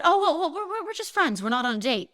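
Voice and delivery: high, hurried protest in a retold quote; acting out an awkward correction; flustered denial. Hastily correcting [name] in story.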